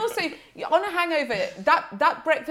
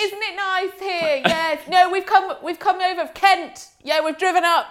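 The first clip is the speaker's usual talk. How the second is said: imitating over-loud, high small talk; hammed-up tipsy socialising at raised pitch; mock loud chatting; 6 semitones higher, 3 dB louder, faster than usual. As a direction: chat noisily across to strangers, playing it for laughs. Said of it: "was screaming, chatting to another pod".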